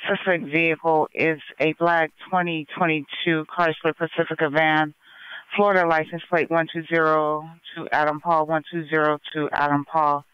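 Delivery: crackly police radio transmission; radio static bursts. Real, not acted. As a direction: dispatcher over a scanner. Police radio dispatch call.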